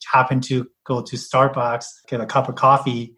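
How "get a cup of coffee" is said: normal talking voice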